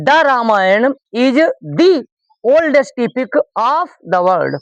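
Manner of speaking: slow, word-by-word model sentence; slow dictation. Shown as a demonstration. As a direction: read each word out slowly for the class. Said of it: "क्या किया जाएगा".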